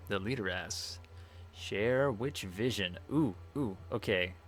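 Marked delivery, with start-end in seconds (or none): 0.1-3.0 slow, portentous reading; grand oracle. Acted. Streamer reads the Leader's question from the game, slow grand oracle. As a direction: intone the question with drawn-out words.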